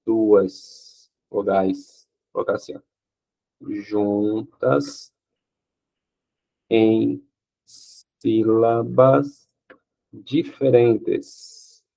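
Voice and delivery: very slow, each word drawn out; writing the definition on the board; slow dictation. Shown as a demonstration. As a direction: dictate "em sílabas diferentes" very slowly. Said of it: "Então".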